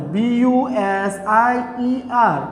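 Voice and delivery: very slow, drawn-out letters; spelling out. Shown as a demonstration. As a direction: dictate each letter with space around it. Spells the word for students. (খুব ধীরে একটা একটা অক্ষর বলে)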